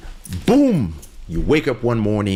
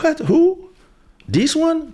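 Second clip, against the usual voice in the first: high, loud incredulous who, this one; scoffing surprise; 4 dB louder than usual. Pretend. The powerful man hears his ex's threat, high incredulous scoff. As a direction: scoff in disbelief at the very idea. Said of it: "You're like".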